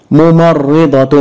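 ممرضة said slowly; slow model word. Shown as a demonstration. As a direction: sound out ممرضة. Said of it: "ضع الشدة بحركتها". (ممرضة ببطء)

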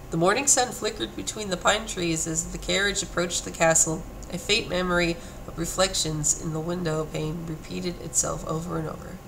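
soft, subdued reading voice; story narration. A narrator's reading. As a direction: read it quietly like a novel. Reads game text aloud.

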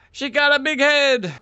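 high, loud cry of surprise; amazed exclamation. Real, not acted. Player spotting a big-headed enemy, amazed high exclamation.

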